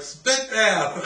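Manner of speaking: high, loud gleeful cry; kids' gleeful shout. Retold or imitated. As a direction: yell it like a delighted child. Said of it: "it was like".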